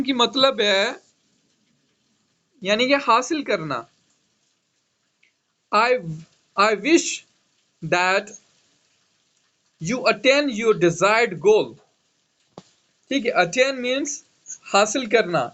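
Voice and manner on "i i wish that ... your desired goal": very slow and measured, word by word; slow dictation. Shown as a demonstration. Teacher dictating an example sentence for students, slowly. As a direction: spell it out slowly so the class can write. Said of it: "dictating an example sentence".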